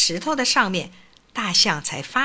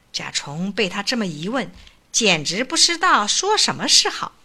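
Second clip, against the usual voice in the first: ordinary delivery, nothing marked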